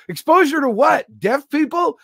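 high, loud incredulous questions; hammed-up outraged disbelief; mock indignation. Acts indignation over bad gig.

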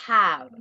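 "Have" said loud and low as "ab", short a and b-like v; model pronunciation. Shown as a demonstration. Teacher drilling the class on "Have", loud and low. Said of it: "es have".

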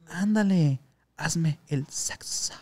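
slow, drawn-out pleading come-on; a tipsy passenger begging the driver, slow and teasing; mock seductive plea. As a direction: drag it out like a hammed-up seduction. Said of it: "Así me decía".

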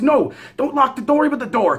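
high, urgent retort; panicked order. Retold or imitated.

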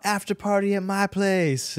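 high, drawn-out mock party call; party yell. Dancer shouting to the nightclub crowd, slow high party call. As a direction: call it across the club. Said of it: "I just yelled out".

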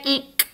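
"Nk" as nasal NG closed with a soft K; NK sound. Shown as a demonstration. Teacher introducing the NK sound, quiet nasal Nk. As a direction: say "Nk" quietly, the nose sound then K.

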